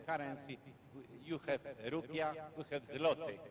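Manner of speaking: ordinary talk, nothing marked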